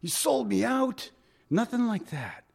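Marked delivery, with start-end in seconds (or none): 0.0-1.1 the way Jesus did not speak to Judas, as a mock sneer; mock angry rebuke. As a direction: play the bitter betrayed friend, half in jest.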